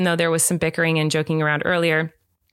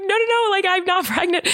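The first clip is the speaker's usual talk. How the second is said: high, laughing protest; retold flustered reassurance, giggle breaking through; frantic denial; 13 semitones higher than usual. Podcaster replays reassuring scared husband, high and frantic.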